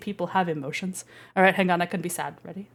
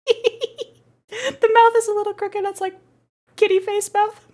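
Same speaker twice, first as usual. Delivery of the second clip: much higher, cutesy squeaky voice; 12 semitones higher, slower than usual. Pretend. VTuber to chat about kitty face mouth, high cutesy voice. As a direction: play it up, cute and high.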